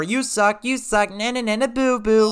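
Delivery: high sing-song jeer. Real, not acted. Winner teases his beaten brother, childish taunt.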